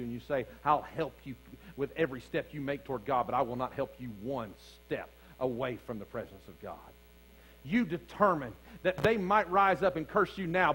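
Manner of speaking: steady preaching, building toward the climax; preacher building his case; sermon delivery. Acted. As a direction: lead the congregation through the story.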